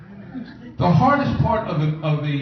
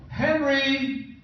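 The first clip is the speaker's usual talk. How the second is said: high calling out for Henry; a lightly voiced yell, higher; mock yell; 9 semitones higher, much slower than usual. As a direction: call across the room, imitating her. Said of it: "she yells out".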